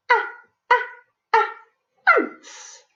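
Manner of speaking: Ah, ah, ah as quick clipped short a, then ants breathed out; mock startled ah ah ah ants; short a phonics sound. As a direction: sound out the a like a child spotting ants. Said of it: "She looks down and says".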